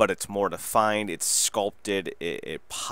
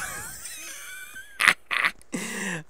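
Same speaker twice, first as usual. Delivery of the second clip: high squealing laugh. Real, not acted. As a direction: burst out in a shrill laugh. Laughing at own story.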